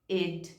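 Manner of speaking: "id" said as a full syllable, ee-d; teacher models the suffix sound "id". Shown as a demonstration. Teacher demonstrates the ending to students. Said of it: "Ele pode ter o som id".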